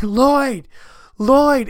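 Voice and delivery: very high, loud acted yell; screaming for dog. Owner with hot dog calling Lloyd, screaming. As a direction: scream the name after a runaway pet.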